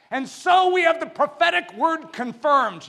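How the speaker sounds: high, loud preacher's proclamation of the verse; proclaimed. Acted. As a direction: ring it out to the whole church.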